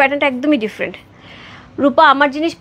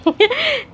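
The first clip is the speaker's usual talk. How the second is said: high laugh at a slip of the tongue; real high-pitched giggle; 11 semitones higher than usual. Real, not acted. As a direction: laugh at your own slip. Laughs at own word slip.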